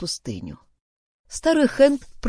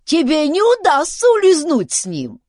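high, loud squealed threat; enraged chief's squawk; angry shouting; 11 semitones higher, 7 dB louder than usual. A narrator's reading. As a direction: yell after a would-be thief. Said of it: "кричал он".

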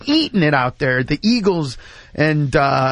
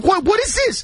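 scornful demand; 12 semitones higher than usual. Retold or imitated. Homeless man rejects Big Mac.

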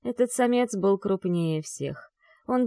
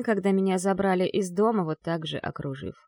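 usual speaking voice

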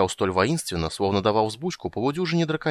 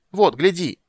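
high, loud demand to look; insistent high yell; 9 semitones higher, 6 dB louder, slower than usual. A narrator's reading. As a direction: cry it out like an excited little creature. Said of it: "она завопила, что есть мочи".

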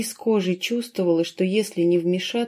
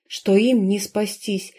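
ordinary delivery, nothing marked

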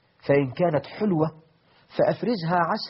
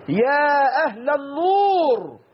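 high, slow, drawn-out call into the night; 10 semitones higher, 4 dB louder, much slower than usual. Acted. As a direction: call across the dark to the fire's owner. Umar calling out at night.

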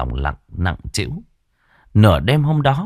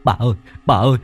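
fast, high, louder panicked stammering; terrified stammer; 8 semitones higher, 3 dB louder than usual. A narrator's reading. Vĩnh begging the ghost, high panicked stammer. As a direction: babble in terror, tripping over words. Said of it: "sợ quá lắp bắp".

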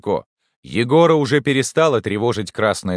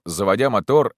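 usual speaking voice

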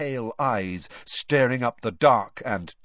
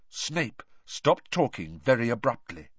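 usual speaking voice

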